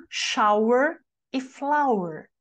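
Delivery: shower e flower slow, the rhyming endings stressed; shower e flower drawn out so the rhyme rings; rhyme demo. Shown as a demonstration. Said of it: "que nem mata e lata".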